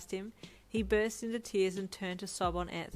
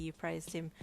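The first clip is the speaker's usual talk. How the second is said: ordinary delivery, nothing marked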